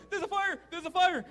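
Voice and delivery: high-pitched mock panic; urgent, repeated play alarm; pretend fire alarm. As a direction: cry it out like a kid playing fireman. Boy shouts pretend fire.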